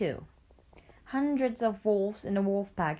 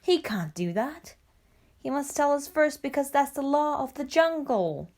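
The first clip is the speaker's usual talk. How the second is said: higher, slightly raised voice of objection; surprised protest; 6 semitones higher, 3 dB louder than usual. A narrator's reading. Father Wolf objects to Shere Khan coming, surprised.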